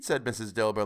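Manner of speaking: plain reading voice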